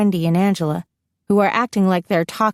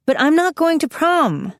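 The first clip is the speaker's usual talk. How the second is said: higher, insistent protest; 9 semitones higher than usual. A narrator's reading. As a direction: push back against the friends' plan. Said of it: "I protest".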